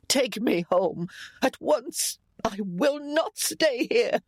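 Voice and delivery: high, slow, insistent matriarch; agitated demand. A narrator's reading. Ailing mother demanding to be taken home, agitated. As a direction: insist word by word, pitch climbing.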